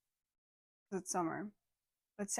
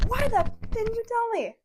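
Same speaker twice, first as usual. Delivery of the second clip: much higher imitated tantrum; mock screaming; 12 semitones higher, 3 dB louder than usual. Mimicking the customer's tantrum for a friend, mock scream. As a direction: overplay his angry scream. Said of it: "a man yell like that".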